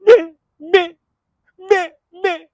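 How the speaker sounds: high loud garbled yelling; slurred shouting. Retold or imitated. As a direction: shout the insult all slurred. Imitates deaf brother yelling insult.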